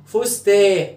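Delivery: "فستا" cut open on the long vowel, no final n; nun removed. Shown as a demonstration. Teacher shows the word is ruined, final nun dropped. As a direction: say "فستا" loudly, the n of fustan missing. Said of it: "شيل النون".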